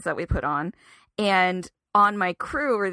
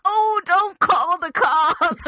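high, slow, laughing "don't"; pleading with the sister, laughing nervously; panicked laughing plea; 9 semitones higher, 5 dB louder, much slower than usual. Retold or imitated. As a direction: beg through nervous laughter. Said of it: "I was like".

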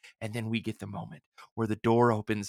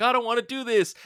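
lightly voiced protesting child, higher pitch; imitated reluctant kid; 10 semitones higher, 4 dB louder than usual. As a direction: whine it lightly, like a kid refusing. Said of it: "flashing back to him as a kid".

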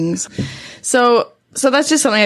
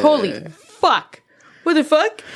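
retold shock; 7 semitones higher than usual. Retold shock at seeing figure.

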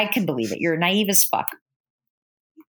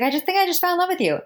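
much higher and fast, gushing; gushing girl; 12 semitones higher, faster than usual. Retold or imitated. Girl gushes to the coworker who helped her, high and fast. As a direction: blurt it out all starstruck. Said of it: "And she's like".

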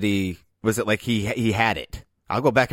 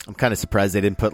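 ordinary delivery

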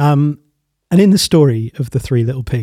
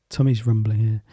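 ordinary delivery

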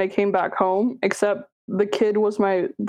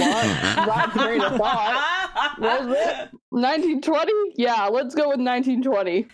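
put-on old-timey announcer; 4 semitones higher than usual. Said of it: "you should be, like, an old-timey announcer".

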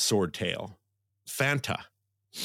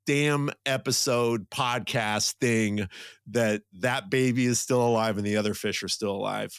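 normal talking voice

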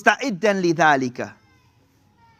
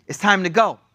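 ordinary talk

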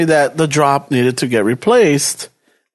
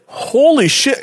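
stunned; 6 semitones higher, slower than usual. Retold or imitated. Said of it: "I'm like".

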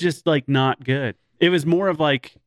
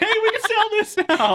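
much higher, bright mock pitch of a greedy studio exec. Mocking studios cashing in on Hemsworth's fame, high exec voice. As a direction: play the cash-grabbing producer, up high and chirpy. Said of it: "and they're like".

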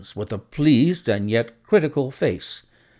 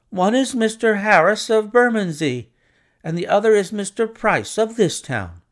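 glib; 9 semitones higher, 4 dB louder than usual. A narrator's reading. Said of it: "said our clerk glibly".

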